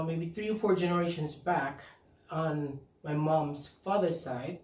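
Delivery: ordinary talk, nothing marked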